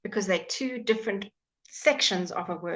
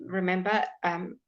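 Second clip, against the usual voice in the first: normal talking voice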